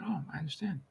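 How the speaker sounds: softly whispered sympathy; whispered understanding. Retold or imitated. Consultant answering the worker, hushed and sympathetic whisper. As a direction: breathe it out gently.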